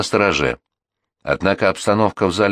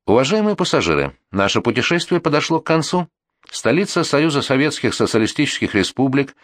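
raised, formal cabin announcement; airport announcement; 4 semitones higher than usual. Acted. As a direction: announce the landing to passengers. Landing announcement on plane.

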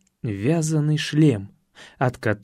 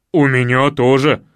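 соглашается Кукушкин; 3 semitones lower, 6 dB louder than usual. Acted. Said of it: "согласился Кукушкин".

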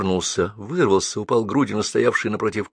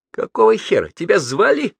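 high, loud snap back; irritated retort; 10 semitones higher, 5 dB louder than usual. A narrator's reading. Murka to the man barging into the cabin, irritated retort. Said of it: "огрызнулась Мурка".

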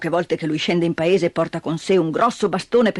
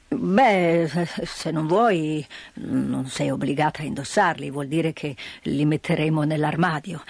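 put-on gruff old man; 6 semitones lower than usual. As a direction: answer like a gruff but patient grandfather. Grandfather answers.

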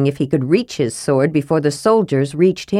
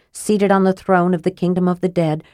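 plain reading voice

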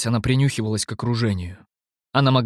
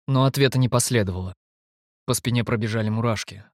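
ordinary delivery, nothing marked

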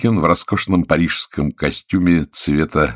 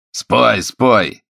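sharp demand; 6 semitones higher, 4 dB louder than usual. A narrator's reading. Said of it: "внезапно резко сказал".

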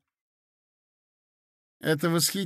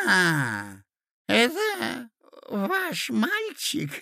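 put-on high, slow, cooing old man; doting old prince; 6 semitones higher, much slower than usual. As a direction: coo over the boy, senile. Old prince admiring boy.

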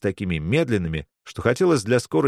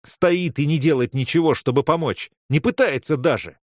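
indignant; 6 semitones higher than usual. Acted. Angry complaint in the scene.

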